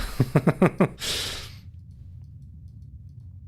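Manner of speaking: high breathless laugh; airy giggle. Real, not acted. Laughing at own slip.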